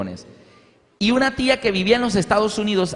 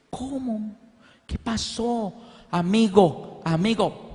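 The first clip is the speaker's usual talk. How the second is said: imitating her deaf son's halting speech, high and soft; deaf boy's voice; 5 semitones higher, 3 dB quieter, much slower than usual. Son talking to his mother about the broken hearing aid, hushed high imitation.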